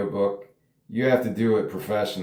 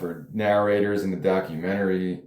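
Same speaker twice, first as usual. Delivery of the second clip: usual speaking voice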